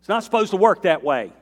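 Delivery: indignant protest. Retold or imitated. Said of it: "He's like".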